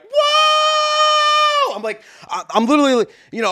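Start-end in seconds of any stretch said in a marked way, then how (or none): 0.0-1.8 huge high excited roar; crowd roaring whoa. Retold or imitated. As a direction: yell it like a hyped crowd. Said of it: "these people were like".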